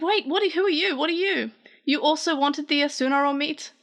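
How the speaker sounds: high, puzzled rapid questions; startled questioning. Acted. Pulsemon confronting the stranger.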